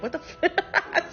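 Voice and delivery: high, drawn-out words breaking into laughter; cracking up. Real, not acted. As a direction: lose it laughing before the punchline. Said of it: "It's so funny when I get to the punchline".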